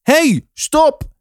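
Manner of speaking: high-pitched call to halt. A narrator's reading. Guard calling after someone walking away, high shout. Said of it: "toen ik iemand hoorde roepen".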